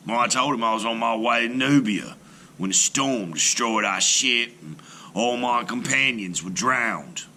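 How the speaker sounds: usual speaking voice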